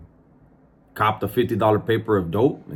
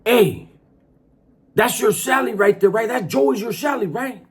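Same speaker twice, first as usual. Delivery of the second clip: raised, high-pitched grilling; yelling at him; 11 semitones higher than usual. Retold or imitated. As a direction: yell an accusing question across the yard. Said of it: "he starts yelling to him".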